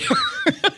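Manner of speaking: a high burst of laughter. Real, not acted. Host laughs at her own dad joke, high laugh. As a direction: crack up at the punchline.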